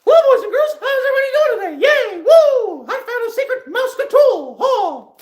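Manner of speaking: very high, loud cartoon falsetto; Mickey Mouse. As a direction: squeal it out like a cheery cartoon mascot hosting a kids' show. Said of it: "is the Mickey Mouse voice".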